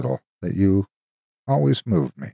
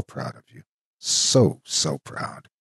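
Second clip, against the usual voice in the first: choked up; 2 semitones higher, 5 dB quieter, slower than usual. Acted. Ghost's emotional letter.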